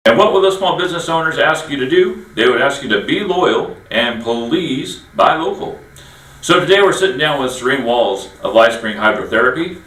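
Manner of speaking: upbeat host pitch, the slogan slowed down; announcer read. Acted. Host pitching loyalty to local businesses, a light ad read. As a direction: lean on the buy-local slogan like an ad. Host's show segment read.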